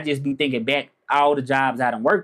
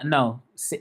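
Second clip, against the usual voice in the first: ordinary talk, nothing marked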